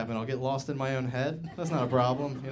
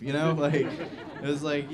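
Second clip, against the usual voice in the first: ordinary delivery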